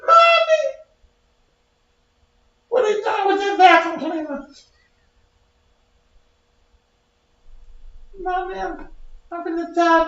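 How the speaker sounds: put-on very high, desperate little baby voice; pleading baby voice. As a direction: plead for your life like a tiny baby.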